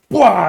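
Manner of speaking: loud, high "bwah" burst; imitated kick impact. Telling how he kicked them, imitated kick impact. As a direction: blurt out a big smashing noise.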